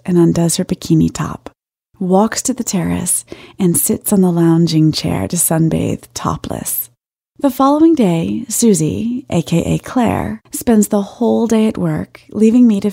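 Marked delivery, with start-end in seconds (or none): none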